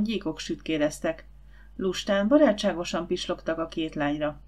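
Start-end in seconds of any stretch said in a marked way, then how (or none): none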